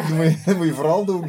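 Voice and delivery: laughing while talking; words through a laugh. Real, not acted. Laughing encouragement at the end.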